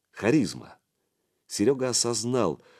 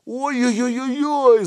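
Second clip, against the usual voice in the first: high, loud, drawn-out wail; long shrieked oy-oy-oy; howl of pain; 7 dB louder than usual. A narrator's reading. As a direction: yelp as if your wrist is twisted. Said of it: "завопил подручный".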